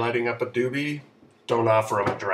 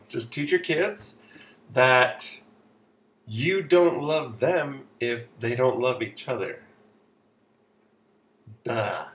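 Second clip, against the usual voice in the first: normal talking voice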